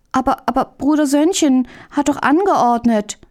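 high, hesitant protest; pleading little-brother objection; anxious reasoning. A narrator's reading. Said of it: "versuchte Hähnchen Möhnlein zur Vernunft zu bringen".

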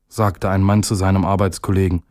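plain reading voice

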